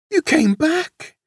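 high, slow, delighted wonder; smiling surprise. A narrator's reading. Wolf finding the lamb by the fire, happily amazed. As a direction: say it high, with a smile. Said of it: "said the wolf with a smile".

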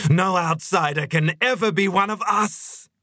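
very high, climbing fanatic cry; ecstatic cry. A narrator's reading. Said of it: "she cried".

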